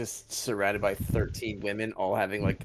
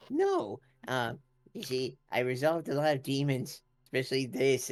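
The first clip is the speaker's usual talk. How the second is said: lightly voiced dragonborn; easy, breezy character voice; Leech voice. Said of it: "and then he just".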